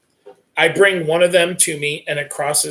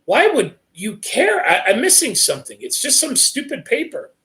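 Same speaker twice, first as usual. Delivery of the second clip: confused; 7 semitones higher than usual. Acted. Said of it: "Sam becomes more confused".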